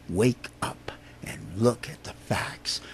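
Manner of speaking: hushed, urgent whisper. Real, not acted. Telling listeners to wake up, whispered. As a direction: lean in and breathe it.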